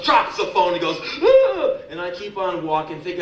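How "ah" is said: high, loud mock shriek; short imitated scream of alarm; startled scream. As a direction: yelp as if recoiling in shock. Woman recoils, drops the phone.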